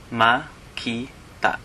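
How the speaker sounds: "Ma-ki-ta" spoken slowly, each syllable separate; slow teacher breakdown of "Ma-ki-ta". Shown as a demonstration. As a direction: spell out "Ma-ki-ta" syllable by syllable. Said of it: "an example is".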